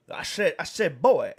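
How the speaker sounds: blustery cartoon rooster, stuttering build to a loud Boy; Foghorn Leghorn. Shown as a demonstration. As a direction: land Boy like a cartoon blowhard. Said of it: "saying it in the Foghorn".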